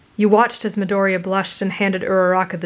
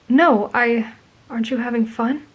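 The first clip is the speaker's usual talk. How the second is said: a touch of sadness, voice raised in pitch; mildly sad; 4 semitones higher than usual. A narrator's reading. Boy asks the traveler about the dance, mildly sad. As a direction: ask it with understated sadness. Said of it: "he asked sadly".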